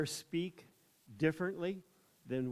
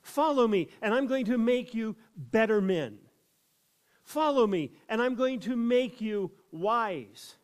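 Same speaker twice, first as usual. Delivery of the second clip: higher and louder, ironic false promise; mock proclamation; 7 semitones higher, 6 dB louder than usual. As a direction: ham up the empty promise. Mock-quoting a wrong version.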